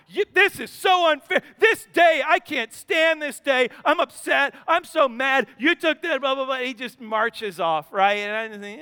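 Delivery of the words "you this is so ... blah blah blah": mock tantrum. Son storming off angry.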